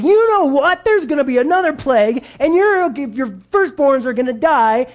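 high, loud, fed-up rant at Pharaoh; exasperated outburst. Retold or imitated. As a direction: scold him like an angry prophet who has run out of patience. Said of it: "and he's like".

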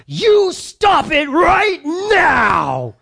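put-on yelling at kids. Pastor reenacting losing it at his kids outside the library. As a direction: explode at six kids in a van. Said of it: "and I was like".

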